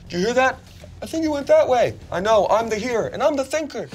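high comic henchman voices; silly knights. Acted. Game master voices distant knights.

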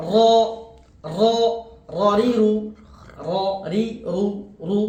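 crisp tongue-tip র, রি, রু; Arabic letter demo. Shown as a demonstration. Teacher demonstrating র, রি, রু to students. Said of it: "এটা কোথেকে উচ্চারিত হবে?".

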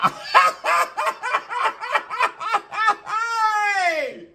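high burst of laughter. Real, not acted. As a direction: cackle at the joke. Laughs after her own joke.

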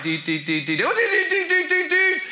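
rapid "dee dee" alarm climbing high; antenna alarm beeps. Acted. Professor's orthodox antennae detecting untruth, mock alarm beeping. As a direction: imitate a detector going off, rising in pitch. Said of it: "I've got, like, these orthodox antennae".

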